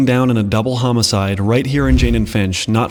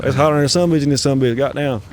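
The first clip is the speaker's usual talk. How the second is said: exaggerated US Southern accent impression; 2 semitones higher than usual. Host imitating folks down South, hammed-up Southern accent. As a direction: complain in a put-on Southern accent. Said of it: "if you go down to the South".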